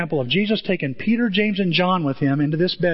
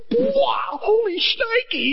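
high squealing amazement; amazed disciples; much slower than usual. Retold or imitated. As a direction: gush like a stunned disciple.

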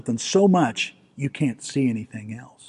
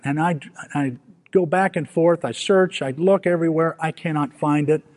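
ordinary talk

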